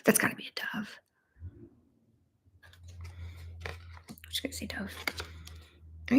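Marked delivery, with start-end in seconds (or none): 0.0-4.9 hushed, unsure whisper; whispering to herself. Real, not acted. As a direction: whisper the guesses half to yourself. Guessing an animal, talking to herself.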